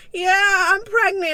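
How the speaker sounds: high, loud, tearful laugh-cry; overwhelmed happy tears as she tells her friend. Retold or imitated. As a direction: let the joy spill into tears. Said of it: "And I start crying".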